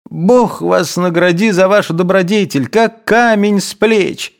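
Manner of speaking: high, joyful relief; relieved exclamation. A narrator's reading. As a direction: cry out in relief. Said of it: "воскликнул Евсей". (радостно воскликнув)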